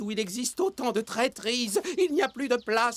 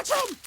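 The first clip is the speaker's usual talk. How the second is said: big high sneeze. Acted. (un gros éternuement)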